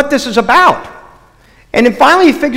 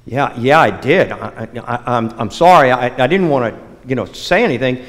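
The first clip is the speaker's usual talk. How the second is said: lower, softer, stammering apology; a caught friend mumbling his embarrassed confession; embarrassed mumble; 8 semitones lower, 4 dB quieter than usual. Acted. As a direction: sheepishly own up, tripping over the words. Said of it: "Raleigh turns all embarrassed".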